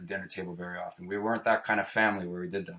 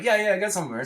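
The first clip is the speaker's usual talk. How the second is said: a light put-on of an eager street dealer, pitched higher; keen seller, lightly hammed; 9 semitones higher, faster than usual. Dealer answering the kids asking for hash, eager.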